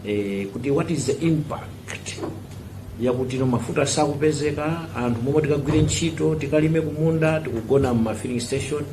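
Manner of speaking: ordinary delivery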